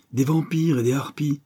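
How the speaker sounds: a touch of teeth-baring scorn; mild growl. A narrator's reading. Poet on the hated critics, mildly growling. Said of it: "gronda Brissenden en montrant les dents".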